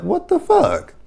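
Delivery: high squealed shock. Retold or imitated. MJ shocked that Nia told Vanessa, squealing. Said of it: "He was like".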